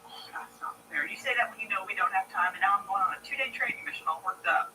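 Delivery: ordinary talk, nothing marked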